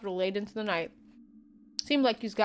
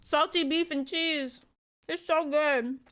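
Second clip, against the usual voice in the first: savouring; 6 semitones higher, slower than usual. Real, not acted. Savouring the food.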